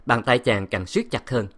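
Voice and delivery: usual speaking voice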